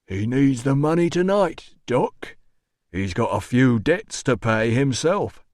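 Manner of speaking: loaded doorman voice; knowing doorman. A narrator's reading. Doorman revealing the administrator's debts, knowing. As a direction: explain it with knowing menace.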